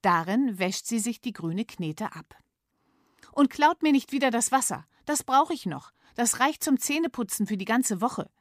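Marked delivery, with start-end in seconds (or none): 3.3-8.3 put-on high, loud, scolding child; stern little girl. Miss Mumm scolds her parents, sternly. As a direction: lay down the law to the parents. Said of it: "sagt sie streng".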